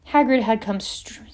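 ordinary delivery, nothing marked

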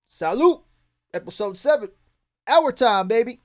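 high, loud hype call; hype sign-on. Real, not acted. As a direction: shout the show name out. Show sign-on.

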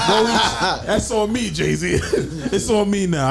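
laughing, slow and high. Pretend. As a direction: laugh through the boast. Joking offer to pay [name].

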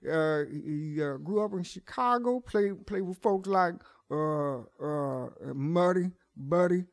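put-on halting, drawn-out old blues player voice. Showing the interviewer his blues character, old blues player. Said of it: "there's a blues player that is eighty-seven years old".

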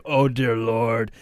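low, slow imitation of an overfed man; middle-aged fat guy. Contestant playing the ad man at night, low lament. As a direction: drag it out low, like a late-night sufferer. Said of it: "kind of a middle-aged fat guy".

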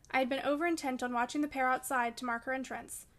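usual speaking voice

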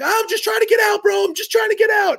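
imitated panicked whine, held high; retold frantic plea. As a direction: plead in a high, mimicked player voice. Retold plea of fleeing player.

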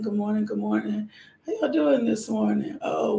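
ordinary delivery

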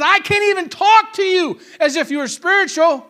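acted outburst of exasperation; exasperated rebuke. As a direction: scold the congregation in a quoted voice. Acts a stern biblical rebuke.

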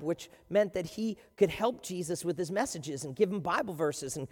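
ordinary talk

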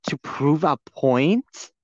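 drawn-out, slow incredulous question; slow disbelief. Real, not acted. Venting about Trisha's accusation, slow incredulous question. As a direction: stretch each word out in disbelief.

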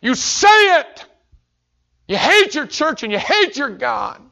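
put-on a drunk father's hateful roar; drunk father's rant. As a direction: spit out the hatred slowly. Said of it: "he said".

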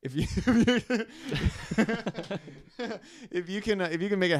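giggling through the words, pitch jumping up; breaking up with laughter mid-sentence; laughing. Real, not acted. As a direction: laugh your way through the invite. Laughing while inviting porn star.

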